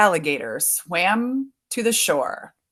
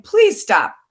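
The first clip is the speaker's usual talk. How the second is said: higher-pitched called-out plea; mild storybook yell; 8 semitones higher, 4 dB louder than usual. A narrator's reading. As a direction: lightly yell after the runaway.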